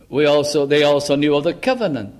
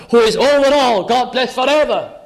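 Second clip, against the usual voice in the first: exultant outburst, raised in pitch; can't contain himself; 6 semitones higher, 3 dB louder than usual. Acted. Preacher voicing Paul unable to contain himself, exultant. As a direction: burst into praise, higher and louder at the start. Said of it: "he can't contain himself when he says that".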